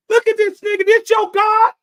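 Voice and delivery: loud, high scornful jeer; loud scoffing jeer; mocking disbelief. Real, not acted. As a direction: laugh at a pathetic god. Mocking Ra to the crowd.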